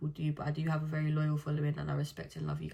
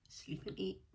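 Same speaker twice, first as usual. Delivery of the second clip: soft unvoiced aside; whispered punchline; 3 semitones higher, 10 dB quieter than usual. Real, not acted. Joking to viewers about loving sleep, whispered. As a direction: hush it like a guilty secret.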